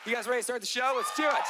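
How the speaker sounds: crowd hype. Real, not acted. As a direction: fire up the crowd. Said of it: "hyping the crowd".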